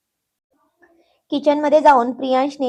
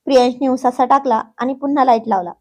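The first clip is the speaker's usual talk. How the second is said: ordinary delivery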